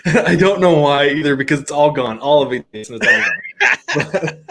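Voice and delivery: can barely get it out for laughing. Real, not acted. Laughing at own story.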